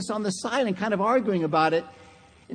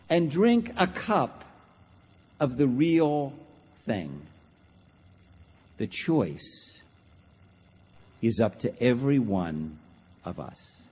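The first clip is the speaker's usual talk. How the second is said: slow phrases with long pauses, sinking low; solemn sermon close; 8 semitones lower, 3 dB quieter, slower than usual. Real, not acted. Preacher leaving the choice to each listener, slow, falling and hushed. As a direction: bring it down gravely to a hushed amen.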